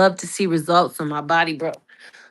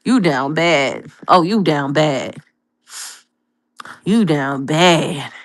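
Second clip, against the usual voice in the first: self-scolding; 6 semitones lower, slower than usual. Retold or imitated. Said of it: "I said".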